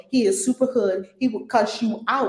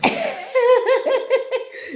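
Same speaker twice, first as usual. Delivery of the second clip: bright giggling laugh; high burst of laughter; 5 dB louder than usual. Real, not acted. Host laughing at her own admission.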